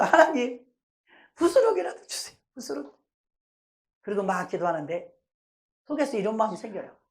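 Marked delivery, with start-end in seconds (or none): 0.0-2.9 higher, mock-weeping prayer; imitated tearful plea. Believer begging God for crumbs, mock tearful. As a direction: act out begging in tears, fading at the end. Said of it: "막 울면서".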